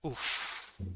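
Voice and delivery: ordinary delivery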